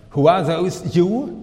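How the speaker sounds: ordinary talk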